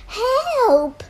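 high, loud storybook cry; acted shout for help. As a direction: call out for rescue, pitched well up. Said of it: "they all shouted".

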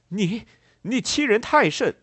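high, loud, outraged stammer; acted indignant shout; angry. A narrator's reading. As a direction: burst out in fury at the insult. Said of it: "愤怒的列莫尔说道".

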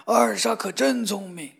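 loud admiring father; loud praise. Acted. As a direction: declare it loudly to everyone. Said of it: "他大声说".

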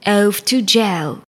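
slow, low royal command; stern king. Acted. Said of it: "the king cried".

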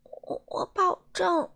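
stretching groan. A narrator's reading. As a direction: stammer it through a groan. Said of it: "贪吃先生呻吟着说".

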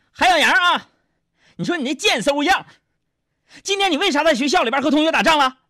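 high, loud mock telling-off; acted mother's shrieky interrogation; scolding mother. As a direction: tear into the son, pitch way up. Mother slaps and scolds son.

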